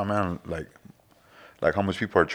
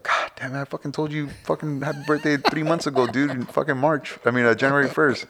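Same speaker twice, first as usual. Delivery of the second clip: annoyed rant; 6 semitones higher than usual. Retold or imitated. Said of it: "And it's like".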